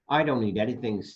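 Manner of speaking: plain reading voice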